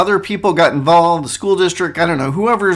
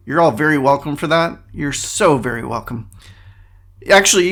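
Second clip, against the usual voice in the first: ordinary talk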